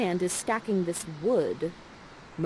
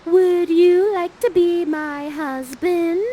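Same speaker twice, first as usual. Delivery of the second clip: much higher, slow sing-song flirting; flirty call; 10 semitones higher, 8 dB louder, slower than usual. Acted. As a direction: coo at him to catch his eye. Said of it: "And Miranda's like".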